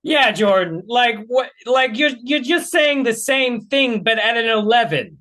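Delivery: high-pitched, stumbling exasperation; exasperated protest. Real, not acted. Viewer objecting to the debater's inflated answer, exasperated. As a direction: push the pitch up in frustrated disbelief.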